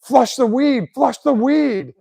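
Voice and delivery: high, loud mock-urgent shout; joking alarm call. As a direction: yell a fake warning as a prank. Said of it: "just as a joke, I was like".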